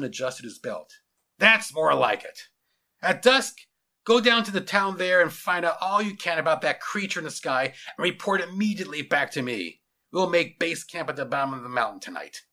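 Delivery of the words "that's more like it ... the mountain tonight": loud, authoritative orders; stern general. Acted. General sending his lieutenant to town, commanding. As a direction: hand down orders with military authority.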